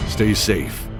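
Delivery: normal talking voice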